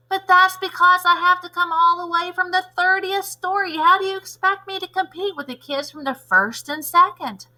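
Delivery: put-on indignant kid voice. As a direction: protest it isn't fair.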